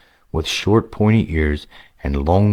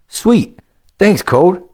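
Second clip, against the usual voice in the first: put-on lightly raised, eager young gamer voice; a casual young player, a bit higher than the narration; upbeat young adventurer, higher pitched; young hero Greylick; 14 semitones higher, 6 dB louder, slower than usual.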